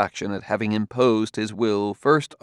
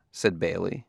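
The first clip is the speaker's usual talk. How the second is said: plain reading voice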